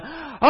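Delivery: long, high, full-force "aah" yell; crowd's shout. Acted. Two million people shouting at the walls, full-force yell. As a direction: yell like one of a roaring multitude. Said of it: "two million people going".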